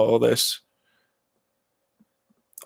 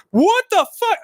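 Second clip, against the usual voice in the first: mock yell. Retelling his mother screaming at his door, imitating her yell. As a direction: hurl it the way she did. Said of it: "just screaming at me".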